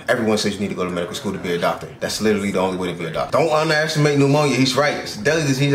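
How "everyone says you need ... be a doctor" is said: sarcastic rapid answer. Acted. Mock sarcastic self-answer.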